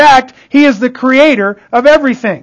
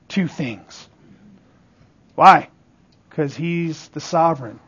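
low, soft and weighty; hushed reverence; 8 semitones lower, 10 dB quieter than usual. Real, not acted. Preacher explaining God is sovereign, in a reverent hush. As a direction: let the voice sink low and soft.

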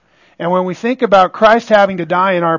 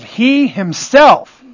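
"himself" drawn out slowly and higher; slow, raised emphasis on "himself"; stress on himself; 5 semitones higher, 4 dB louder, much slower than usual. Shown as a demonstration. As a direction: lean hard on "himself", stretching it out. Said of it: "Emphasis on himself".

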